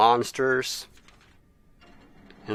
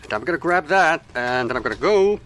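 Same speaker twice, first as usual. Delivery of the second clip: excited; 5 semitones higher, 5 dB louder than usual. Real, not acted. Rushing past a monster.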